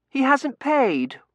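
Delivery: put-on high, loud, slow child voice; piercing childish objection; objecting little girl. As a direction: tattle like a bossy child.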